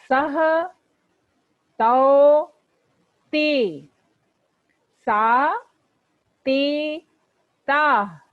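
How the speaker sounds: loud, slow classroom chanting of forms; recitation drill. Shown as a demonstration. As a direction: chant each form clearly for the class to repeat. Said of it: "साथ साथ बोलिए".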